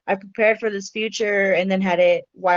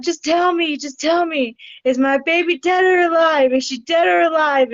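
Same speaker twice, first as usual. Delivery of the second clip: higher, louder, pleading for an answer; desperate plea; 8 semitones higher, 3 dB louder than usual. Retold or imitated. Mother begging the ultrasound technician for the truth, desperate and raised. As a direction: beg her to just say it, raised and urgent.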